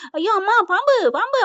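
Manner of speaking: high-pitched cry of alarm; mock panicked scream. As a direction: act out a snake scare. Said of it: "அப்படின்னு கத்த".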